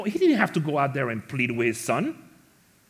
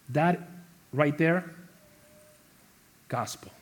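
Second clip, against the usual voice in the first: normal talking voice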